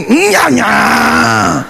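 high, slow mock taunt; drawn-out teasing nyah nyah; child's taunt, drawn out high. Mocking gesture.

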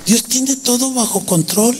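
put-on lightly high child's voice, innocent; little girl's voice. A little girl reassures the weary pastor, high child's voice. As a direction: say it like a small girl comforting an adult. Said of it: "levanta su manita y me dice".

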